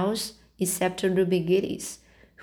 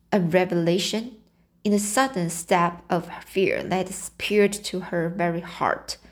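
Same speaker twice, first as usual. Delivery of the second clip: usual speaking voice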